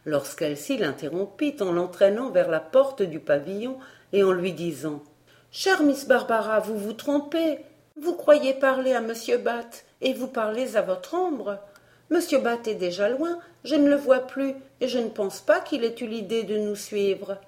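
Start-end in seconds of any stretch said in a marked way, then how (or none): 5.5-17.4 higher, reassuring character voice; young Elsie, reasoning. Acted. Elsie leading Barbara away from the tree, reassuring. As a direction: talk her round, voice pitched up.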